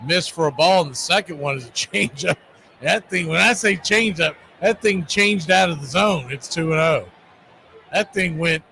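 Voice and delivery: ordinary talk, nothing marked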